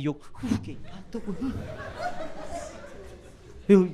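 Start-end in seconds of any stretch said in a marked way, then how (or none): 0.1-1.5 hushed awe. Retold or imitated. Awed hushed reaction retold.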